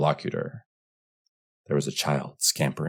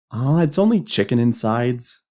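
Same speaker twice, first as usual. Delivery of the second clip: put-on loud, high boyish scoff; boy's voice pooh-poohing; 9 semitones higher, 7 dB louder than usual. A narrator's reading. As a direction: dismiss it like an unimpressed kid. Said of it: "scoffed a boy".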